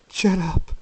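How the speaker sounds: high, loud but falling; exasperated plea. Acted. Jacob worn down by the voice, strained pleading. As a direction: let the outburst drop away, still strained. Jacob spent after his outburst.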